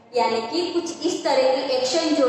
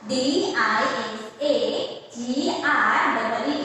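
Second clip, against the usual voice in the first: slow spelling; much slower than usual. Shown as a demonstration. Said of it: "कौन सा word से हमारे पास?".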